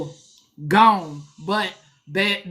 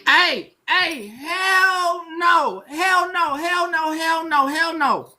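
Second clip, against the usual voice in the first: high, repeated indignant hell no; outraged refusal; 6 semitones higher, slower than usual. Real, not acted.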